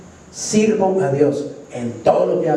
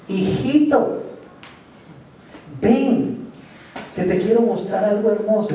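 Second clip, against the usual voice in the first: higher, sweet coaxing tempter; devil luring; 4 semitones higher than usual. Acted. The devil sweet-talking a believer, coaxing and high. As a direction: lure the child in like a smooth devil. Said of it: "cuando el diablo venga a decirle".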